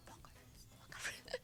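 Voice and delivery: voiceless, barely audible whisper; mock whisper. Interviewer imagining telling family about vocal rest, mock whisper. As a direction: mouth it almost silently, as if saving the voice. Miming someone resting their voice at home.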